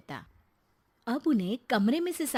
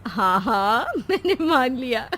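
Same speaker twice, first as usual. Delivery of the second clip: laughing mock surrender, louder; teasing laugh; 3 semitones higher, 7 dB louder than usual. Daughter-in-law teasing her mother-in-law, laughing. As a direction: give in with a chuckle.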